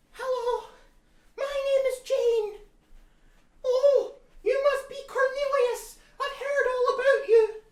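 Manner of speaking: put-on very high, giggly, gushing girl voice; Jane. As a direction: squeal it out like an excited fan. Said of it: "Jane came along one day".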